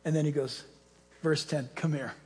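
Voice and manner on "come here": soft, low beckoning; fatherly. Acted. Peter calling his people over after the orders, soft and fatherly. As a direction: draw them in quietly, like a father. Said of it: "he just melts with his fatherly heart".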